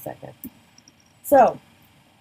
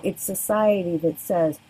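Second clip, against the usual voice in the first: usual speaking voice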